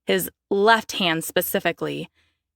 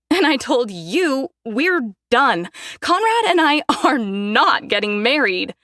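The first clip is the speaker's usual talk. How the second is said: defiant declaration; 5 semitones higher than usual. Acted. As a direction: throw it in their faces. Daphne declaring to parents.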